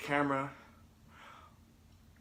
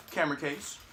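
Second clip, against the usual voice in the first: usual speaking voice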